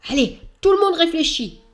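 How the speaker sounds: rally the friends to think; lightly voiced eager creature; Croque-Mouche urging. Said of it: "lance Croque-Mouche".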